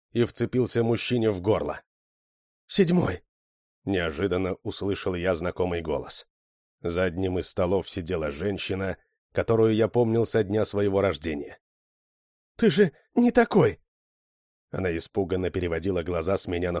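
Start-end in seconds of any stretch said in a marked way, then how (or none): 2.7-3.2 high, sudden call; woman calling out. A narrator's reading. Familiar woman calls to Seventh, high sudden cry. 12.6-13.8 very high, distressed female voice; pleading woman. A narrator's reading. Woman pleads with Seventh, high distressed cry.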